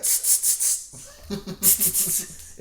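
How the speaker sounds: unvoiced spraying tss sounds; spray hiss imitation. Dad imitating the daughter spraying Febreze in his face. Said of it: "he's like".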